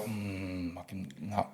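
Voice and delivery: very quiet, low reluctant grumble; muttered disapproval. Retold or imitated. Musician recalling hearing his own master, muttered disapproval. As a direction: mutter it under your breath, unimpressed.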